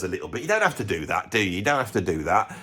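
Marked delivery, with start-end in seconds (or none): none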